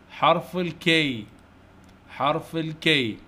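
drawn-out, measured naming; slow letter drill. Shown as a demonstration. Teacher naming the letter K for young pupils, slowly. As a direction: stretch it out for pupils to repeat.

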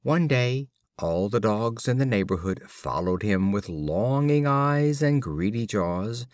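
ordinary delivery

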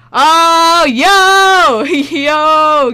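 very loud, high shouts of joy; real ecstatic yelling; excited yell. Real, not acted. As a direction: explode with delight at the card. Opens the best card.

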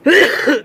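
a sharp, loud cough; real cough. Real, not acted. Vlogger with a cough coughs on camera, a real sharp cough. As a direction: cough hard, then apologize.

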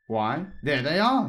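triumphant. A narrator's reading. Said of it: "said the king triumphantly".

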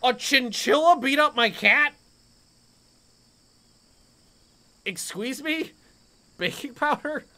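high, incredulous question; can't believe what happened; disbelief. Real, not acted. Cat beaten by chinchilla.

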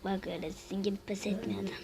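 a fast secret whisper; whispered aside. Real, not acted. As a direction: lean in and whisper it to the viewers. Aside to viewers in game.

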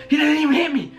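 high, excited realization; excited disbelief. Retold or imitated. Fighter relives the missed punch to viewers, high and excited. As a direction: voice lifting as the miss sinks in.

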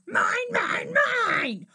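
high, loud dinosaur roar; big possessive monster bellow. A narrator's reading. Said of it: "they heard a roar".

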